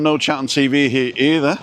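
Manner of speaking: usual speaking voice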